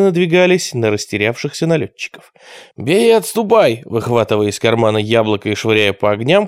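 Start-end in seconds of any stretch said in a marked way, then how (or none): 2.8-3.8 high-pitched battle command; shouted order. A narrator's reading. Gang leader ordering a fighting retreat, shouted. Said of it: "крикнул Квакин".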